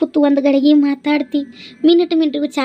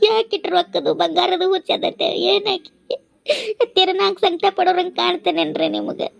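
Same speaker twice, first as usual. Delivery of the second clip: tearful complaint; 6 semitones higher than usual. Acted. Daughter-in-law complains, crying.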